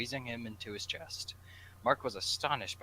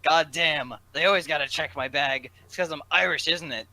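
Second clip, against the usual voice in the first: loud, higher complaint; yelling; 7 semitones higher, 14 dB louder than usual. Acted. Jack yells about bag checks, loud and high. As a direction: bellow it across the security line. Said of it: "Jack yelled from behind him".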